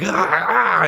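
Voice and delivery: high, sharp gasps of a man in flashback; hammed-up flashback gasps; mock pained gasps. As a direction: gasp like a startled hero. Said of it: "remembers, like".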